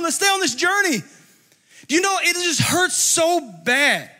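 high, loud, impassioned preacher's cry; fired-up preaching. Acted. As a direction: preach it at full force, pleading.

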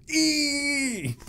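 long high squeal; creature squeal. Acted. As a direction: squeal like a hit cartoon monster. Imitated creature squeal in battle.

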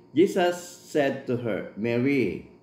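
slow, measured Bible reading; scripture reading. Acted. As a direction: read the verse slowly and solemnly. Reads scripture aloud in English.

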